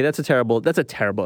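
ordinary talk

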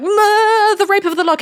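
high, loud blurted answer; hammed-up flustered squeal; mock panicked student. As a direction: blurt it out like a student put on the spot. Student put on spot by tutor.

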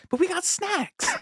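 high squeaky whine, held back in volume; Casey Affleck impression. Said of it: "running bit with us".

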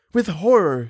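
high, emphatic dread; horrified. A narrator's reading. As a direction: answer with anguished horror. Christine's anguished answer.

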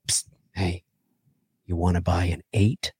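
low, hushed shady dealer voice; secretive raspy sales murmur. Retold or imitated. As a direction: whisper it like a shady seller. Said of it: "And he's like".

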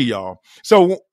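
usual speaking voice

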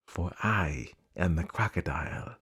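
low, hushed creature voice; luring crocodile. Acted. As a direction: reveal it softly and low. Crocodile reveals himself to the child.

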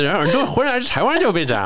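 high, loud mock Beijing accent. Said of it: "有说他们的那个口音啊".

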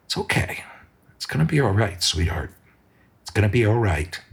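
soft, hushed reassurance; tender comfort. Acted. Ben hugging sobbing Cindy on the floor, hushed and tender. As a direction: soothe her gently, almost whispering. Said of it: "gives her a hug and says".

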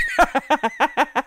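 bubbling chuckle; quick rolling giggle; laugh. Real, not acted. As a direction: burst out laughing at yourself. Laughing at own story.

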